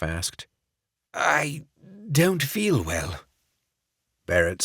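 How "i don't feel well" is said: feeling sick. Acted. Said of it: "Barrett grimaced".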